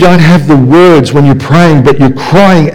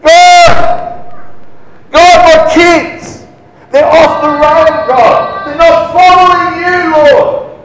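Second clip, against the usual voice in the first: high-pitched wailing prayer, demonstrated; loud crying; 13 semitones higher, slower than usual. Shown as a demonstration. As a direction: cry out to God in a high, drawn-out wail. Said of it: "what a loud crying sounds like".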